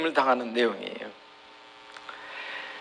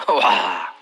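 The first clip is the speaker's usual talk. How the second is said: usual speaking voice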